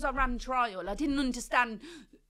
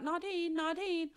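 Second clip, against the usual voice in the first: calling her name; 7 semitones higher, slower than usual. Retold or imitated.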